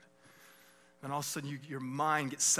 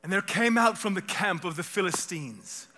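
dramatic, raised and loud, put-on British; mock British accent; 5 semitones higher, 9 dB louder than usual. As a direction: declaim it in a theatrical British accent. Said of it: "in a British accent 'cause it's gonna sound more dramatic".

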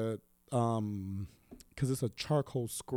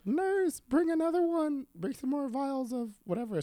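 much higher, imitating the doctor calling out; mock doctor voice; 13 semitones higher than usual. Imitating the doctor telling the nurse, high mock voice. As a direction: squeak out the doctor's order to the nurse.

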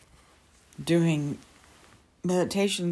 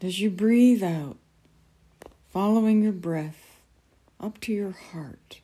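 guided meditation. Acted. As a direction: ease them gently into calm.

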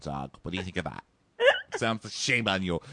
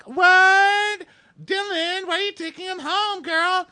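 much higher, camp squeal; shrill mocking shriek; 7 dB louder than usual. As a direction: squeal it out like a scandalised friend. The boys react, mocking.

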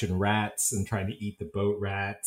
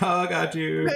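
laughing tease; 7 semitones higher than usual. Retold or imitated. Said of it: "It's like".

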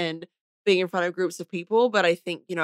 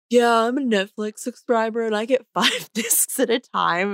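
higher-pitched mock boast; imitated bragging; 5 semitones higher, 4 dB louder than usual. Wife teases husband about his Netflix subscription, mock bragging. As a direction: brag like a proud show-off, teasingly imitated. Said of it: "I remember you bragging".

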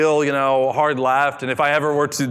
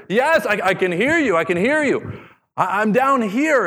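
high eager calling back; thrilled to be heard, call upward; excited relief; 9 semitones higher than usual. A narrator's reading. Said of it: "He was so excited".

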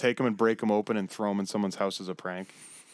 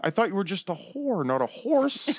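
high shocked exclamation; 7 semitones higher, slower than usual. Retold or imitated. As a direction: squeal it out in disbelief. Retold shocked reaction to date.

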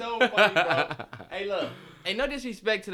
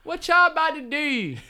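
put-on Baltimore accent; 8 semitones higher than usual. Host teasing Baltimore talkers, exaggerated Baltimore accent. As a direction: ham up the Baltimore vowel on "do". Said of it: "That's a Baltimore accent".

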